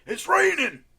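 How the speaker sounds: very high, very loud scream; screamed impression. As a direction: scream the forecast at the top of your voice. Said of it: "he just screamed".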